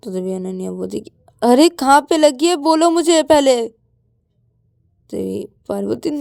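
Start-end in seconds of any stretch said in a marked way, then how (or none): 1.4-3.7 loud, high, worried insistence; exasperated concern, raised voice; urgent demand. Acted. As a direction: press them to answer right away. Urgently asks where it hurts.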